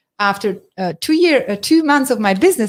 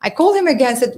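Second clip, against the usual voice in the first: ordinary talk, nothing marked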